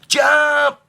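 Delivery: high, loud cry; cried. A narrator's reading. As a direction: shout it joyfully as you leap. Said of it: "cried Nicholas".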